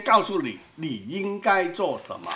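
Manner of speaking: usual speaking voice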